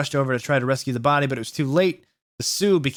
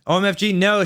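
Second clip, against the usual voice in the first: louder, high alarmed shout; 6 semitones higher, 3 dB louder than usual. A narrator's reading. Lucan shouting as Samaro pulls a knife, louder. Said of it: "shouted Lucan".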